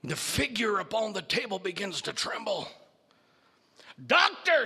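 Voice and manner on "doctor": high, loud alarmed cry; shouting Igor. Acted. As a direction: call out to the doctor in alarm. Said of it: "shouted Igor".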